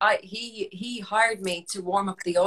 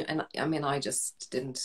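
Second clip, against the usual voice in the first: much softer, slower admission; trailing off; 6 semitones lower, 11 dB quieter, slower than usual. Real, not acted. Admitting she never learned Irish, fading quiet. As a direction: let it fade away sheepishly.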